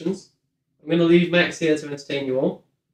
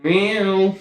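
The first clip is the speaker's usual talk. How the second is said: meowing; 5 semitones higher, much slower than usual. Acted. Meowing at the cat.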